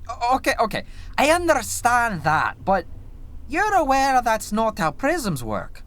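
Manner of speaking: high, strained objection; incredulous questioner. Acted. Reviewer imagines writers' meeting, sceptical objection. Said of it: "I imagine the conversation was something like".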